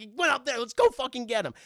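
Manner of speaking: loud, high mock command; rally a partner in irritation; urgent yelling. Burglar urging his partner upstairs, urgent yelling. Acting burglar resuming the chase.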